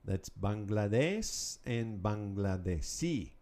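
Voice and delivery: Bangladeshi with the stress on the final i; stress on the last syllable. Shown as a demonstration. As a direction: slowly, land hard on the final i of Bangladeshi. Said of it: "Accent on that last letter there".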